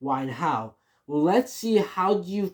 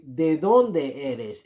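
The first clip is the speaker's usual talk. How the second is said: each syllable said slowly; slow, clear teacher model of ¿De dónde eres?; slow model phrase; much slower than usual. Shown as a demonstration. Teacher slowly models ¿De dónde eres? for learners. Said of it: "you have to say".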